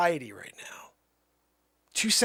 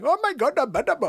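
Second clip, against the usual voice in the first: brief, high burst of Indian accent; short Indian-accent take; 4 semitones higher than usual. Shown as a demonstration. Showing the really short one, brief Indian accent. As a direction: toss off a quick mock Indian exclamation. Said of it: "like the really short one".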